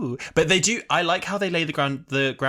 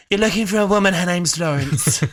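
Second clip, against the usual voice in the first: loud, higher, slowing imitation; mock bold declaration; 4 semitones higher, 6 dB louder than usual. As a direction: announce it boldly, drawing out the end. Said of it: "She was just like".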